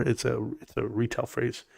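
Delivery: normal talking voice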